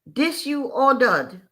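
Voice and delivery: waitress mildly angry in broken English; stated angrily. Retold or imitated. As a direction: lay down the accusation slowly in broken English. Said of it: "in turn she stated angrily".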